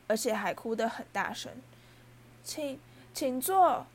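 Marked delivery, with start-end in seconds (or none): 2.4-3.8 loud, slow, high elf wail; wailing cry. A narrator's reading. House-elf overcome at being asked to sit, crying out. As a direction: stammer it out as an overcome creature's cry. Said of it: "他哭叫道".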